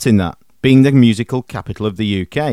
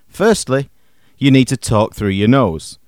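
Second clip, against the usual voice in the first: normal talking voice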